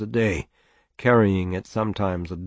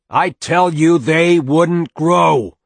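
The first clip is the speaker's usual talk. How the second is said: loud, high, slow, every word hit hard; emphasis on every word; 9 semitones higher, 10 dB louder, slower than usual. Acted. Father overruling his wife about willows, emphasis on every word. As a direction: hammer out each word of "they wouldn't grow" loudly and slowly. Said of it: "with emphasis on every word".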